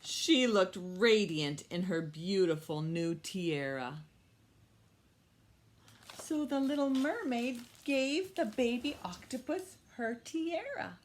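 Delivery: put-on bright, lively story read for kids; storytime reading. Teacher reading a story to her young class. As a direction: read it like a picture book to little ones. Reads story aloud to children.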